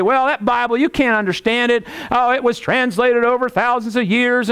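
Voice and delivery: lightly mocked objector, pitched high; imitated Bible doubter. Preacher mimicking someone who dismisses the Bible, high-pitched objection. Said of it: "You ever have someone say".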